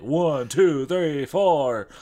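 loud band count-in; count-in shout. Acted.

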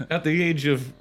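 high, laughing through words. Real, not acted. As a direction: giggle through it.

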